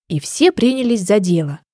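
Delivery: ordinary delivery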